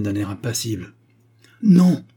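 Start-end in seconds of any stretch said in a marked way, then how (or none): 1.6-2.0 high, loud cry; sudden forceful outcry; shouted refusal. A narrator's reading. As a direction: shout it out to stop him. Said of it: "s'écria Agar".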